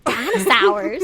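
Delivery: loud, drawn-out movie voice; Jurassic Park voice. Pretend. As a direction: ham it up like the movie. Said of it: "That's my Jurassic Park voice".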